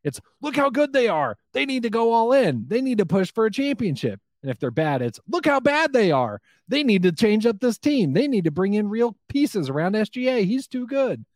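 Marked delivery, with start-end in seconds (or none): 0.4-4.2 higher, insistent talking-head rant; mock pundit hype. Podcaster parodying national pundits praising the Thunder, high mock hype. 5.3-11.2 higher, insistent hot-take rant; mock pundit outrage. Podcaster parodying national pundits slamming the Thunder, high mock outrage.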